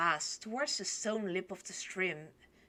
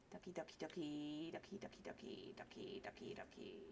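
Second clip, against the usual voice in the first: very soft chant, fading to a whisper; quiet muttering; 6 semitones lower, 18 dB quieter, faster than usual. Real, not acted. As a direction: mumble it to yourself while searching. Muttering while searching for emotes.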